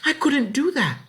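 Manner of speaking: put-on high, strained girl's voice; a scared girl refusing; high child voice. Ellen's voice.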